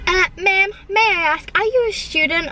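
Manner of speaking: high-pitched imitation of a drive-through worker; put-on accent. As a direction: pipe up as an exaggerated intercom order taker. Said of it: "he literally said it in that accent".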